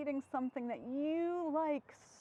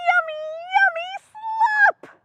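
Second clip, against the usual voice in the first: higher, gleeful squealing pig; Piggy voice; 12 dB louder, much slower than usual. Acted. Piggy cheering about her favourite food, high gleeful squeal. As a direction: squeal it out with delight.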